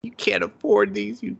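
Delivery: a hint of a high teasing scold; mock scolding girlfriend. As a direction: tease him with a high scold. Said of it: "mocking her reply".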